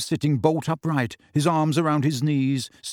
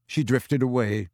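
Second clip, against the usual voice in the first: ordinary delivery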